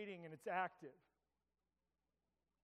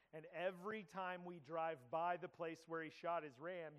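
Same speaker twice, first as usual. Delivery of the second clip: ordinary talk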